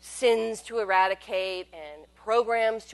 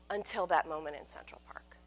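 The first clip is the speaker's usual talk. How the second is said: whisper; 5 semitones lower, 8 dB quieter than usual. Real, not acted. Dramatic hush at turning point.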